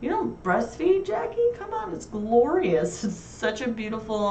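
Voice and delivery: a high, slow, sarcastic sales pitch; mock gushing. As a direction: oversell it to tease her friend. Teasing Jackie about breastfeeding.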